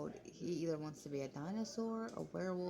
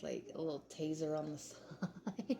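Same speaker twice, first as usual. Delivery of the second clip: ordinary talk, nothing marked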